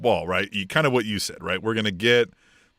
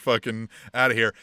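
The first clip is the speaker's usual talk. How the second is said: normal talking voice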